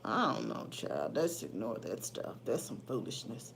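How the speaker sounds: very low, soft mutter fading to a whisper; hushed, private grumble; muttered aside. Real, not acted. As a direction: dismiss it under your breath, low and quiet. Dismissive aside about troll's nonsense.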